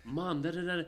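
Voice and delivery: lightly voiced daughter rattling off to mom; mock daughter on phone. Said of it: "She's like".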